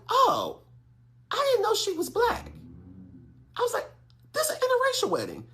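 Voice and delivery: very high, squeaky surprise; startled realization. Retold or imitated. Guest walking in and seeing the couple, startled squeak. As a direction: squeak it out as a sudden discovery.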